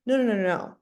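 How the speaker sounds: ordinary talk, nothing marked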